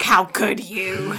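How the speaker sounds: slow, drawn-out mock outrage; mock scolding. Little girls on the bridge scolding the boy, mock outrage. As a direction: draw it out like an appalled onlooker.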